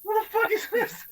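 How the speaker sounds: the wife blurts out her disbelief; wife's shocked outrage. Retold or imitated. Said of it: "His wife's like".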